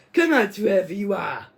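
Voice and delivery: villain shouting. Retold or imitated. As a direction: roar it out at the hidden intruders. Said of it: "Then Maxim's like".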